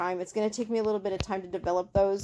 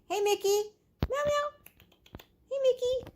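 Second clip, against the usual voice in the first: much higher sing-song coo to a kitten; pet-talk calling. Real, not acted. Owner calling her kitten over, high baby-talk coo.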